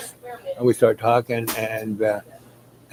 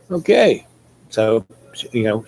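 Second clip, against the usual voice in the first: ordinary delivery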